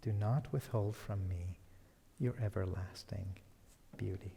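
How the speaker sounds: hushed, low devotional close; hushed prayer. Acted. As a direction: let the prayer's last line sink away. Closing line of prayer repeated softly.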